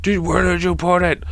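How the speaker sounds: retold high-pitched outburst; imitated angry yell. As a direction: act out yelling at grandma, held back in volume. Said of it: "he yells at his fucking grandma".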